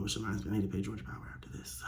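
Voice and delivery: quick whisper under the breath; fast, soft whispered note to self; whispered aside. Real, not acted.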